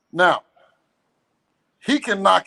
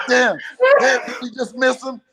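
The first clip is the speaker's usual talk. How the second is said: mock panic; 6 semitones higher, 3 dB louder than usual. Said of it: "I wanted you to be like".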